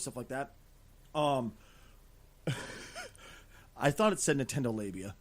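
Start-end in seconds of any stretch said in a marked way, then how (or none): 2.4-3.0 breathy silent giggle; silent laugh. Real, not acted. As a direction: wheeze out a quiet laugh. Laughs at dyslexia story.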